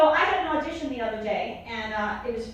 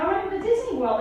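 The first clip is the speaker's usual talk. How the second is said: put-on higher, pleading child; excited kid; 8 semitones higher, faster than usual. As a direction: beg like an eager kid. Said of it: "So I'm like".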